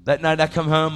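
normal talking voice

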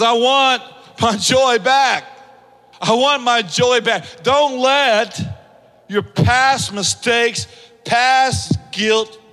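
slow, loud, high preaching push; preaching at full force. Acted. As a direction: drive it home from the pulpit.

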